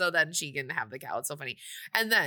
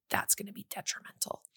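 hushed, much softer side comment; whispered aside; 9 dB quieter than usual. Real, not acted. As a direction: whisper it like gossip. Aside about the birthday mistake.

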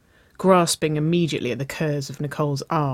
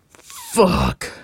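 higher growl on a drawn-out word; 4 semitones higher, 3 dB louder than usual. A narrator's reading. Nicole reacting to her lover's confession, growling. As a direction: growl it out under strain. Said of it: "Nicole growled".

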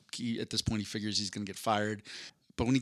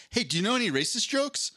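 put-on high-pitched, keen kid asking; eager schoolboy question; 10 semitones higher than usual. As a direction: imitate an eager classmate springing a question. Schoolmate eagerly asks on bus.

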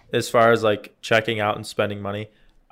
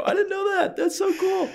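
much higher, dopey wide-eyed voice; mock naive self. Mocking his own amazement to the guy behind him, squeaky high voice. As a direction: play yourself as an amazed little kid. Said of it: "I go".

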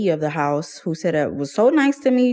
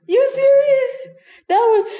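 incredulous; 4 dB louder than usual. Retold or imitated. Finds the place closed on holiday.

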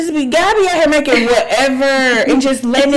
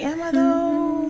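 mock skeptical; 5 semitones higher, 4 dB quieter, slower than usual. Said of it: "And I'm like".

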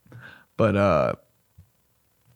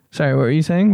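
ordinary talk

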